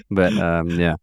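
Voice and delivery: ordinary talk, nothing marked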